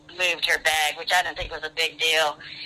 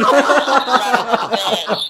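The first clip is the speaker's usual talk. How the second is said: laughing curse; 5 semitones higher, 9 dB louder than usual. Real, not acted. She vomits in pool.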